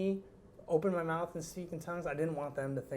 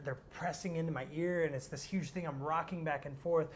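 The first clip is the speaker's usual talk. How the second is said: usual speaking voice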